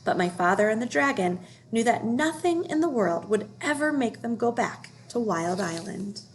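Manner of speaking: usual speaking voice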